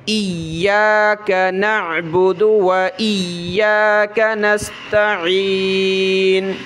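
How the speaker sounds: slow melodic recitation with the یا doubled; Quranic recitation. Shown as a demonstration. Teacher reciting the Fatiha verse for pupils, slow melodic recitation. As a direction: recite the verse slowly, pressing the shadda. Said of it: "یہ لفظ آپ پڑھتے ہوں گے".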